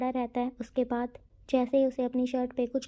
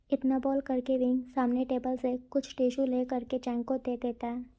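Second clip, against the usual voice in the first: ordinary delivery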